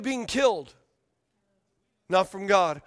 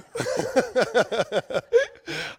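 laughing; 4 semitones higher than usual. Real, not acted. Preacher breaking up over his mic troubles, laughing. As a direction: burst of real laughter.

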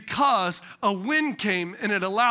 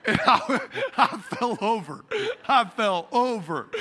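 laughing at himself; slower than usual. Real, not acted. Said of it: "laughs at himself".

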